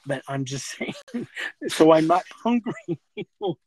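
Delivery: nervous laughter through the words; words breaking up with laughter; laughing. Real, not acted. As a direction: laugh so as not to cry. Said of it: "I'm laughing 'cause I don't wanna start crying".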